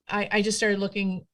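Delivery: normal talking voice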